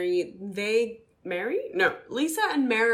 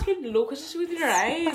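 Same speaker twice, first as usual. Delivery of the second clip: mock scolding; 8 semitones higher, 5 dB louder than usual. Voicing the lady scolding Lisa.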